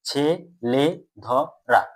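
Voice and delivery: ছে, লে, ধ, রা in four separate beats; short pause after each of ছে, লে, ধ, রা; syllable by syllable. Shown as a demonstration. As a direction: demonstrate the breaks in ছে, লে, ধ, রা. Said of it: "এভাবে তো আমরা উচ্চারণ করছি".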